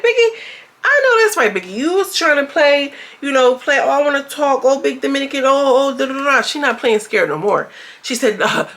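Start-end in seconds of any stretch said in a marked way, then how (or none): 0.0-6.4 hyped cheering. Real, not acted. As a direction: cheer her on like ringside. Cheering Biggie during fight.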